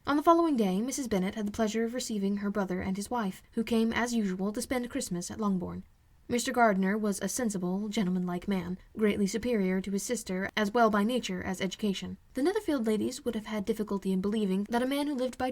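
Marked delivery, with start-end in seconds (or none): none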